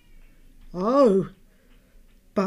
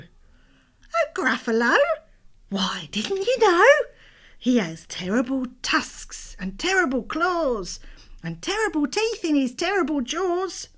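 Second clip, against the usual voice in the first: high little mouse voice; 8 semitones higher, 6 dB louder than usual. Acted. Mouse describes the Gruffalo.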